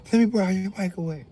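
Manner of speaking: hint of a wheedling woman; mock Felicia. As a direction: sweet-talk for the microwave. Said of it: "She said".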